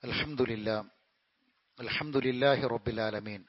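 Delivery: the preacher resumes gently after the pause, calm and low; calm second khutbah. Acted. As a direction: settle into a quiet, measured closing sermon.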